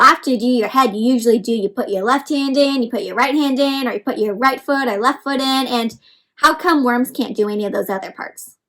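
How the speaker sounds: normal talking voice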